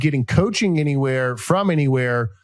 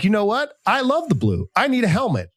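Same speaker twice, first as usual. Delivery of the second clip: higher, excited fan voice; imitating a fan deciding to buy a helmet, higher; mock Michigan fan; 7 semitones higher than usual. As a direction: play a fan suddenly sold on helmets.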